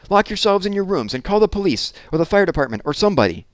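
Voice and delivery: high, loud, breathless command; frantic orders. A narrator's reading. Maid frantically telling the children to hide, loud high voice. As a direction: urge them to flee in a panic.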